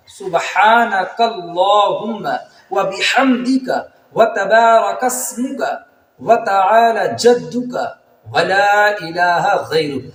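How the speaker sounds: slow, drawn-out Arabic prayer; careful recitation. Shown as a demonstration. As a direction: recite every vowel fully and slowly. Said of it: "صحیح کیا ہے". (آہستہ صاف تلاوت)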